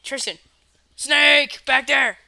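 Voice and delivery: sudden high warning shout; fake alarm shout. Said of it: "Dylan, just be like".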